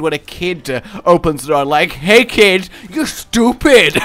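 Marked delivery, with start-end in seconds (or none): 1.9-3.9 higher, louder laughing jeer, slow; mock insult. Door-to-door crier insulting a child at the doorstep, laughing jeer. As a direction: sneer at the kid with a grin.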